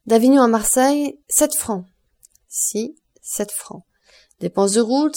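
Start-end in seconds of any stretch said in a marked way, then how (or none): 2.5-3.8 slow, softer, spelled out while writing; slow dictation. A narrator's reading. Son writing the fares down, slow and soft. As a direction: jot it down word by word. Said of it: "Albert prit une plume et écrivit".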